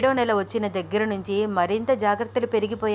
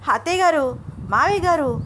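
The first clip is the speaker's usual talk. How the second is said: loud, high calls for help; urgent shouting; 6 semitones higher, 4 dB louder than usual. A narrator's reading. Panicked husband calls his in-laws, urgent shout. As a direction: shout to wake the household. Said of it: "అంటూ గట్టి గట్టిగా అరిచేశాడు".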